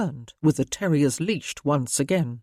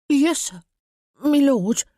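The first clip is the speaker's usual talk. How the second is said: put-on eager boy; 9 semitones higher, 5 dB louder than usual. A narrator's reading. Heartened boy answers.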